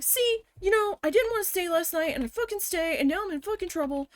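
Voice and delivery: high-pitched aggrieved complaint; retold anger. Worker in trouble for staying late, retold anger. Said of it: "I was mad".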